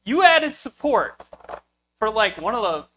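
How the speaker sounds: mock outrage, voice climbing as patience snaps; losing his cool. Retelling his booth rant to the Moroso rep, heated and loud. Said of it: "I was a little upset".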